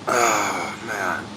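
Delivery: low groan from the icy cold; in ice cold water. Real, not acted. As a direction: groan through the freezing water. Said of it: "it gets a little painful".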